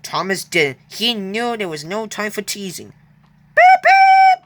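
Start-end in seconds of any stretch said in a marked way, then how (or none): 3.5-4.4 very high, loud whistling beeps; high, loud playful train whistle. Acted. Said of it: "whistled Thomas".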